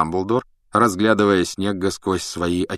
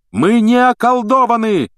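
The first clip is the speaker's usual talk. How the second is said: high, loud indignant cry; worked up; 8 dB louder than usual. A narrator's reading. Harry denying they are bewitched, worked up. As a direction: protest at full voice. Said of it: "разошёлся Гарри".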